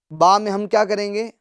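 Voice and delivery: ordinary talk, nothing marked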